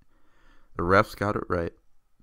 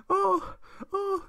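mock fan squeal. Mocking Packers fans.

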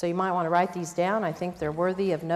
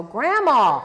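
put-on high, loud laughing kid voice; imitating the boys; 8 semitones higher, 8 dB louder than usual. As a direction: mimic gleeful grandsons, laughing. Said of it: "the boys go".

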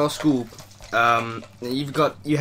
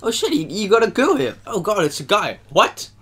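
retold surprise; 6 semitones higher than usual. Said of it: "I really was quite genuinely surprised".

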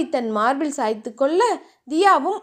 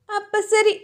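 higher, teasing lilt; mischievous; 6 semitones higher than usual. A narrator's reading. Wife teasing her husband about having a baby, mischievous. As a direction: tease him like it's a joke order. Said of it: "தியா குறும்பாக கேட்கவும்".

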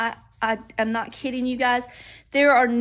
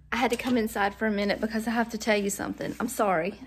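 ordinary delivery

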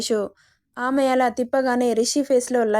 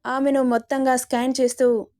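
ordinary delivery, nothing marked

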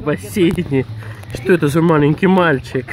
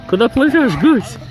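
squeaky praising a dog; 8 semitones higher, 4 dB louder than usual. Real, not acted. Cooing at the swimming goose.

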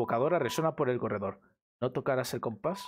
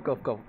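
fake cough; 2 semitones lower than usual. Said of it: "mensaje subliminal".

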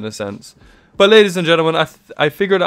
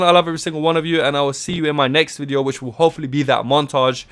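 ordinary delivery

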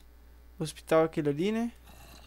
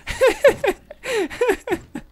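high nervous laugh; 6 dB louder than usual. Real, not acted. Laugh while fleeing.

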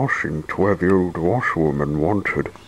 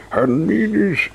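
lightly voiced wizard; 13 semitones higher than usual. Wizard scolding Mister Mean, lightly voiced high. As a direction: lecture like a playful wizard. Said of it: "added the wizard".

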